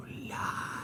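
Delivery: unvoiced whispered accusation repeated. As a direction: breathe it again, creepy and low-key.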